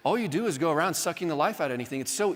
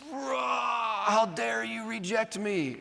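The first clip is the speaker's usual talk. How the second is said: hammed-up roar, much higher, slow on "how dare you"; mock angry outburst; 7 semitones higher, much slower than usual. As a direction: blow up in mock fury at a tiny remark. Overreacting to gentle feedback about eggs.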